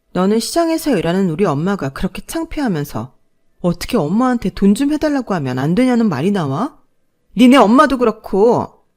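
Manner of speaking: anger building to a loud outburst; furious daughter. Acted. Daughter furious at her husband, building to a shout. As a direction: let the anger boil over at the end. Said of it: "딸은 더 분노를 하더라고요".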